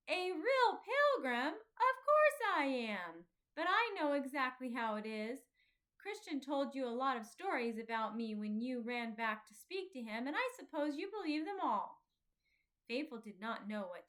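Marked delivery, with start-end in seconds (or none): 0.1-12.0 put-on hurt pride flaring up at a younger boy; indignant outburst. Offended boy snaps back at Faithful, indignant and loud. Talkative angrily defends himself.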